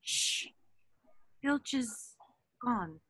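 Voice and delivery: soft, hushed listening voice. Acted. Hermione listening for Filch at the door, soft and hushed. Hiding, ear pressed to door.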